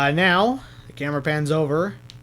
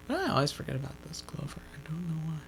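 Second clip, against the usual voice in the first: whispering; 13 dB quieter, faster than usual. Real, not acted. Muttered aside after laughing.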